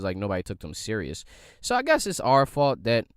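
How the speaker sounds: usual speaking voice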